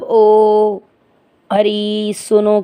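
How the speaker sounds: ओ, हरि, सुनो with drawn-out final vowels; prolonged calling vowels. Shown as a demonstration. Teacher demonstrating extra-long vowels, ओ, हरि, सुनो held out. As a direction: stretch ओ, हरि, सुनो like a mild call. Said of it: "प्लुत स्वर".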